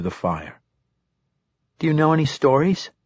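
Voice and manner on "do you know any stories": put-on higher, eager girlish voice; a curious little girl asking; young girl voice.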